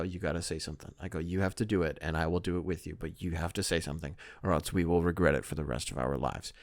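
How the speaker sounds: low, hushed, pressing urgency; urgent hushed plea. Retold or imitated. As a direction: push your brother quietly before it's too late. Urging brother as owner approaches.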